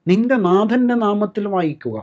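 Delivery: usual speaking voice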